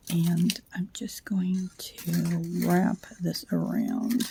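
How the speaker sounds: slow, soft whisper. Real, not acted. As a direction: breathe the words while you work. Late night, whispering while crafting.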